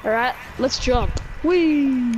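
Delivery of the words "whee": playful whee. Real, not acted. Jumping off a ledge.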